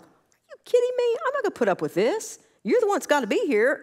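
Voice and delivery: high, loud mock indignation at a rude caller; retold indignant outburst. Counselor retelling her reply to a cursing client, in high loud mock outrage.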